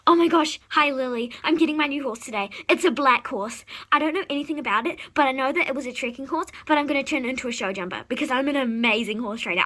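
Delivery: rapid gushing excitement; fast, breathless chatter to a friend; excited babbling. Acted. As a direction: rattle it off, too excited to pause.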